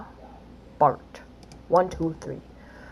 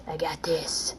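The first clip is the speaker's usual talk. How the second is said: whisper; 7 semitones lower, 9 dB quieter than usual. Real, not acted. Before attempt.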